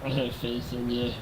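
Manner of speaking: usual speaking voice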